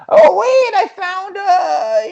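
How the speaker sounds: very high, loud, slow mock discovery; shrill excited customer. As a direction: squeal like she found something. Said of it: "she was like".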